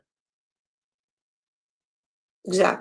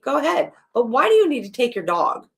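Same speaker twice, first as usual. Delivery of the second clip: ordinary delivery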